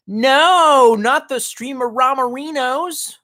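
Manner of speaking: high, drawn-out fake wail; mock dismay.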